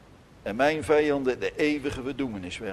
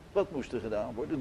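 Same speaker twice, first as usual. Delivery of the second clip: much softer and a little quicker; quiet repeat; 4 semitones lower, 10 dB quieter, faster than usual. Shown as a demonstration. Preacher repeating his question softly to the congregation. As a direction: drop it to a soft, hurried aside.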